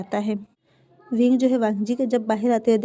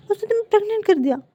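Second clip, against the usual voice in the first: much higher, fast outraged cry; 10 semitones higher, faster than usual. A narrator's reading. Brother furious at the boyfriend, high fast outburst. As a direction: rush it out in protective fury.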